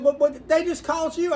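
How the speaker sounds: high, loud astonished protest; imitated disbelief. Customers challenge shop owner about calls, loudly incredulous. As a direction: exclaim it as the baffled customer.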